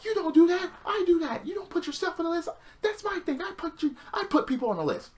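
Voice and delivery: high, put-on whiny Jericho rant; angry Jericho impression. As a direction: squeal it like a furious Jericho. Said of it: "He's like".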